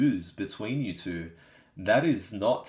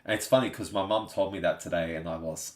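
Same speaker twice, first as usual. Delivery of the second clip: ordinary talk, nothing marked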